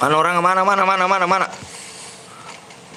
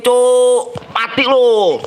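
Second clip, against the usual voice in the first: taunting shout; 7 semitones higher, much slower than usual. Real, not acted. Gamer shouting at enemy while shooting.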